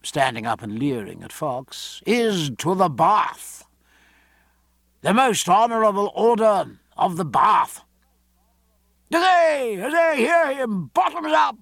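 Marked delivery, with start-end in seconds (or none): 2.0-7.8 loud, high, grandly declaimed toast; crying a toast. A narrator's reading. Loder leering at Fox as he toasts, loud proclaiming. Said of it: "cried Loder". 9.1-11.6 very high crowd hurrahs; cheering. A narrator's reading. The others answering Loder's toast, high-pitched cheering. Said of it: "cried the others".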